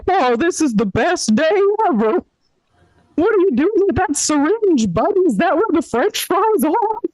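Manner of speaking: high, bright-eyed happy dog voice; excited dog voice. Acted. Comic voicing the doomed dog, high and thrilled. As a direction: play the clueless overjoyed dog.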